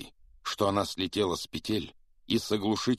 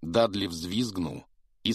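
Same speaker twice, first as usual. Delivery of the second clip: ordinary delivery, nothing marked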